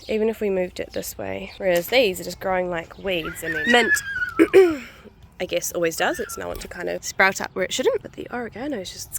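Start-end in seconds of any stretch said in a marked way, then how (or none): 3.4-5.4 squeaky high. Real, not acted. Sudden squeak.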